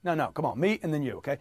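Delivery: ordinary delivery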